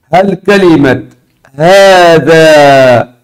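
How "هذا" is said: both vowels of هذا stretched very long; drawn-out test word. Shown as a demonstration. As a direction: present هذا very slowly for the class. Presents test word to students.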